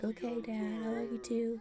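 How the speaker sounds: put-on soft, small toddler voice; whisper-soft like a sleepy little boy; meek kid voice. Toddler making up with his dad, soft and small. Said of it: "He's like".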